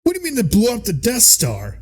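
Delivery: comic disbelief, voice leaping high; mock outrage. Emperor hearing about the Death Star, mock outraged.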